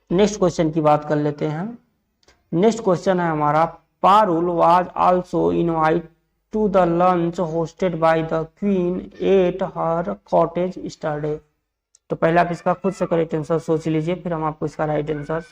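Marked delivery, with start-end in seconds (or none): 4.0-11.4 measured, spaced-out reading of an example sentence; slow dictation. Shown as a demonstration. Teacher reads a quiz question to online learners, slow dictation. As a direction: read out slowly, word by word.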